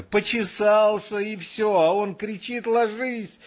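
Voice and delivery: high shouted complaint; indignant yelling. A narrator's reading. Lieutenant complaining about the sentry's order, yelling. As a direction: keep yelling, high and aggrieved. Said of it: "кричал Коля".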